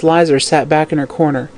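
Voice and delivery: plain reading voice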